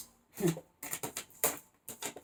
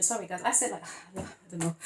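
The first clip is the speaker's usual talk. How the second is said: normal talking voice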